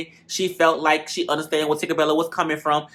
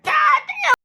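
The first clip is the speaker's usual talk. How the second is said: much higher, laughing god damn; amazed exclaim. Retold or imitated. Reviewer reacting to the knockout, amazed squeal. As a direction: squeal it in disbelief. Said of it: "I'm like".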